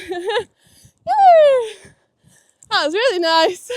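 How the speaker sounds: laughing with delight. Real, not acted. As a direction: giggle through the praise. Joy after a jump.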